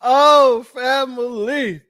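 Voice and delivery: loud burst of laughter then a slow drawn-out family; real amusement spilling into the word; oh family through laughter; laughing exclaim. Real, not acted. Cracking up at a viewer comment.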